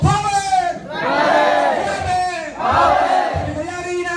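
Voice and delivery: high, loud mimicry of a chanting crowd; mock crowd shouting. Speaker imitating the shouting seminar crowd, high and loud. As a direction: belt it like the hyped-up seminar crowd.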